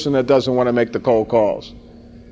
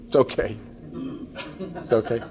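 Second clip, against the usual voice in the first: reassuring; 4 semitones lower, 12 dB quieter than usual. Pretend. Soothing a salesman who won't talk.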